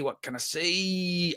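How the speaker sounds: slow and soft, teasing what can I say; coy drawn-out repeat. Pretend. Streamer teasing chat about the rights news, slow and coy. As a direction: stretch it out like you know a secret.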